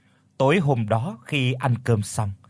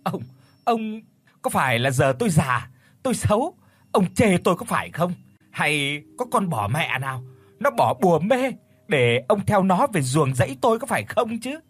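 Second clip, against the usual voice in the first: wailing outburst; 5 semitones higher than usual. A narrator's reading. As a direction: wail to the whole village, half crying, half accusing. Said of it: "bà gào ầm lên như dãy nảy như đỉa phải vôi".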